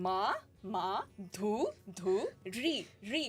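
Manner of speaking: "Ma, ma, dhu, dhu, ri, ri" in separate, paced syllables. Shown as a demonstration. Teaching someone to repeat her name, syllable by syllable. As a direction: drill each syllable twice with a pause, aspirated "dhu". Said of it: "Repeat after me".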